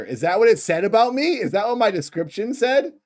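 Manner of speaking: high, loud disbelieving questions; incredulous surprise. Real, not acted. Reacting to own on-screen description, incredulous.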